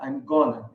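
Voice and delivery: "gonna" as one reduced word; words joined. Shown as a demonstration. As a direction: run "I'm gonna" together like a native. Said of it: "ele, ele junta". ("I'm gonna" emendado, como nativo)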